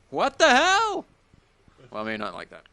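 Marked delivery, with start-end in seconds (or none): 0.1-1.1 exaggerated high-pitched mimicry; imitating the caller. Host mimicking the caller's sign-off, exaggerated high imitation. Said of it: "She went".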